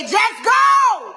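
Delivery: booming high shout of God's order; God's command. Retold or imitated. Preacher shouts God telling her to just go. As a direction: bellow it like a divine command. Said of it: "God said".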